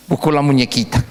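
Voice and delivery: ordinary talk, nothing marked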